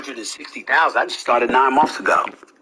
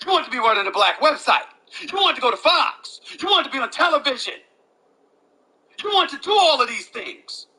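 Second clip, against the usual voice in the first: much higher, fast, fired-up tirade; worked-up rant at a rival, much higher and fast; heated high rant; 10 semitones higher than usual. Real, not acted. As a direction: raise the pitch and hammer the rival nonstop. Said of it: "I don't speak like this".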